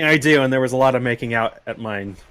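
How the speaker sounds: normal talking voice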